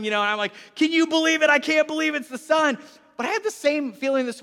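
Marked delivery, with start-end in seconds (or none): 0.7-2.8 high, loud excited marvelling; mock disbelief. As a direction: marvel loudly, hamming up the amazement. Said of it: "I joined him in his fun".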